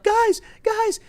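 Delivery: very high squeaky panicked plea; mock panic. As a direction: imitate a sudden panicked squeal. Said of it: "She's like".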